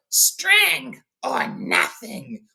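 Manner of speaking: high, drawn-out creature shriek; Gollum. A narrator's reading. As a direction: shriek it slowly, stretching each word. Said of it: "Gollum shrieks out".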